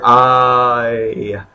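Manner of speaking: I gliding from ah toward ee; one sound, I; diphthong glide. Shown as a demonstration. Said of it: "we start off with the alpha over here".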